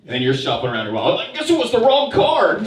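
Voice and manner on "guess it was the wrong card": high, loud smug cashier voice; mock cashier. Cashier mocking a broke customer, smug and high.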